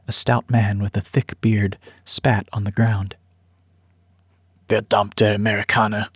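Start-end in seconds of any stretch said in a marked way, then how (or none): 4.7-6.1 angry German man. Acted. Bearded man spits at refugees.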